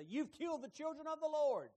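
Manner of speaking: high, loud accusation hurled at Moses; accusing crowd. Acted. Grumbling Israelites accusing Moses and Aaron, loud and indignant. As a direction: throw the blame, indignant.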